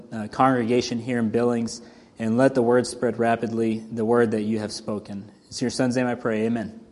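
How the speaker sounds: soft, hushed earnest prayer. Real, not acted. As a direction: pray quietly and sincerely. Leads an opening prayer.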